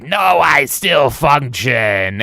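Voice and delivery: slow put-on robot voice; mock Megatron insisting; lightly voiced Megatron. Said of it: "Megatron being like".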